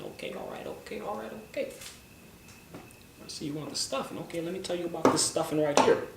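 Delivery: soft, smooth Denzel impression; Denzel Washington voice. Denzel answering a dinner guest, soft smooth impression. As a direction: ease into a cool, smooth Denzel. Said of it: "talk in his Denzel Washington voice".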